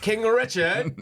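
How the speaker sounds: high, loud cry of the name; mock exclamation. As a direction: cry it out like a film hero. Calls out a film line.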